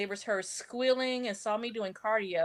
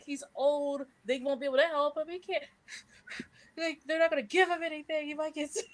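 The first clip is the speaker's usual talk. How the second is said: retold panic; 6 semitones higher than usual. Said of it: "'cause I'm like".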